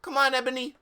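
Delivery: high, loud teacher voice; bright mock professor call. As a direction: read it as the gothic professor. Reading Sinatra's line in character.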